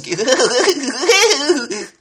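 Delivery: put-on high, loud excited kid laugh; squealing child's laugh; kid's giggle. Shown as a demonstration. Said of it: "hyperactive kid".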